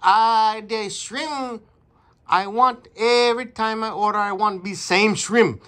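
complaining customer in a mock Chinese accent. Customer complaining about small shrimp, in a Chinese accent. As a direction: grumble through a put-on Chinese accent. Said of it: "we're gonna do a Chinese accent".

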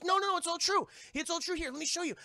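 high-pitched eager insisting; insistent protest. Retold or imitated. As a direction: protest in a high, animated voice, imitating her. Della insists to doubting boys.

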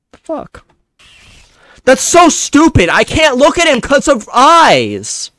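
high, loud, slow exasperated yell; frustrated yell. Real, not acted. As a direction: rant at the screen, outraged. Frustrated at the game.

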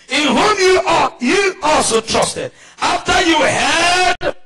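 high, loud preacher's shout; fired-up sermon delivery; full-force preaching. Real, not acted.

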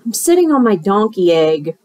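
ordinary delivery, nothing marked

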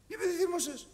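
high-pitched rhetorical challenge; challenging retort. Acted. Preacher confronts a believer's excuse for anger, challenging.

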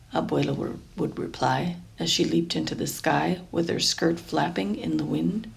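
plain reading voice

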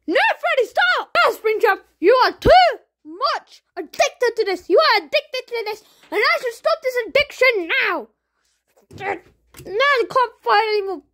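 loud, high anguished pleas; desperate high pleading against the addiction; high pleading shouts. Acted. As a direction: beg him to stop.

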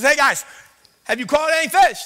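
calling out. Retold or imitated. Jesus calls to the fishermen in their boat, loud and friendly.

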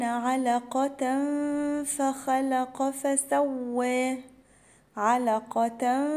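slow, measured recitation of أولى لك فأولى; Quran recitation. Shown as a demonstration. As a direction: recite each letter with its full length. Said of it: "أقولها إزاي بقى الآية". (تلاوة مرتلة ببطء)

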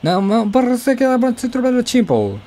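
high, run-on Spanish-sounding gibberish; mock Spanish commentator. Gamer imitating the Spanish commentary for viewers, mock commentator voice. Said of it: "なんかめっちゃチンポって言ってんだよね".

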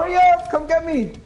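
high, loud call for rescue; urgent plea. Real, not acted. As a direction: hurry teammates along in alarm. Calls teammates for rescue.

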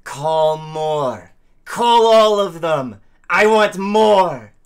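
very loud, high, drawn-out bellow; roaring challenge. Acted. Player acts out a bloodthirsty challenge.

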